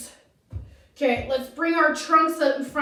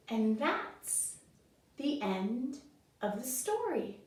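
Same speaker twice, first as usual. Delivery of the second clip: gentle story ending; 9 dB quieter, slower than usual. A narrator's reading. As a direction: wind the story down softly, pausing between phrases. Closing the story for children.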